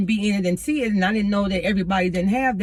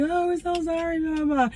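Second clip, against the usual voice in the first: put-on sympathetic kids; 10 semitones higher than usual. Pupils consoling their teacher, high-pitched retold sympathy. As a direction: imitate a class cooing condolences. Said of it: "My kid's like".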